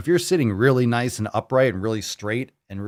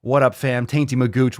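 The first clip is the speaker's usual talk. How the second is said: normal talking voice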